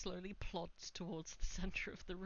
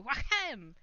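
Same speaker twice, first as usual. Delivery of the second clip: door slam; 6 semitones higher, 10 dB louder than usual. Pretend. Kicking the barrel.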